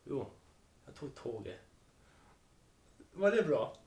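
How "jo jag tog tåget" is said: low, soft Norrland Swedish; Norrland dialect attempt. Shown as a demonstration. Host retries dialect for co-host, subdued Norrland imitation. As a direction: try a laconic Norrland dialect, quietly. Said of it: "Jag försöker norrländska här en gång till".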